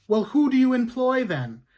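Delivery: loud, high accusing judge; stern judge. Acted. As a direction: hammer her with each question.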